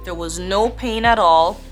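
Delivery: usual speaking voice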